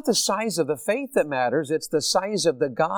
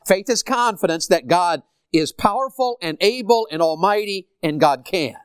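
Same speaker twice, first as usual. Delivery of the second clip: ordinary talk, nothing marked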